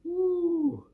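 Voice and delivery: a high, loud cheer of joy; celebrate, high and loud; excited cheer. Real, not acted. Cheer after a good performance.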